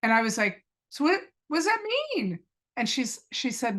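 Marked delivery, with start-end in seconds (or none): 0.9-2.4 high, incredulous question; stunned by sudden hope; surprised. Retold or imitated. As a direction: ask it in disbelief, pitched up.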